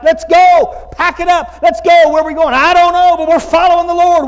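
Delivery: high, loud, fired-up shouts; pumped up and ready to roll out; an eager man hollering to his family to pack up; psyched Abram voice. Retold or imitated. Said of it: "and he's like".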